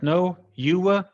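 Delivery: mock tit-for-tat comeback, high and loud; slow, high, loud imitated contradiction; insistent retort. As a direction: fling the blame straight back, drawn out. Retold quarrel, accusation thrown back.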